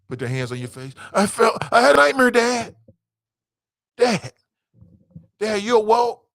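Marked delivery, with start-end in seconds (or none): 1.1-6.2 high, loud mock kid voice; child waking a parent. Kid waking dad after nightmare, high and loud. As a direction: pester a sleeping dad like an insistent kid.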